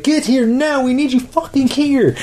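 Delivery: high, urgent phone plea; high-pitched panicked order; urgent demand. Retold or imitated. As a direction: mimic a frantic call for backup. Sarah urgently calling backup.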